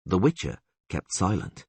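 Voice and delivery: ordinary delivery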